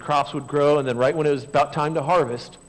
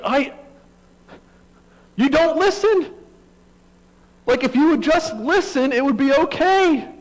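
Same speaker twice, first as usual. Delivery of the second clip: higher, louder fed-up parent; exasperated parent; 13 semitones higher, 10 dB louder than usual. Retold or imitated. Parent snapping at a preschooler who won't listen, exasperated. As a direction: burst out like a parent at the end of their rope. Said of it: "moment of frustration".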